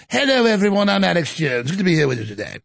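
put-on English accent, falling in pitch; mock English accent. Host pretending to address an England crowd, fake English accent. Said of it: "when I go to England".

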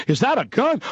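high-pitched, freaked-out question; mock scared. Friend spotting the rifle in the living room, mock scared. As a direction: act out a panicked guest. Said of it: "he acted real scared and freaked out".

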